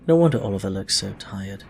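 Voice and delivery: usual speaking voice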